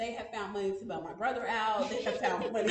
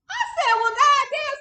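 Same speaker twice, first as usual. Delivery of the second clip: much higher, louder exasperated goddamn; angry outburst; 11 dB louder than usual. Retold or imitated. Daughter retells snapping at her parents, angry outburst. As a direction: blow up at the parents. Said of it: "has now pissed me off".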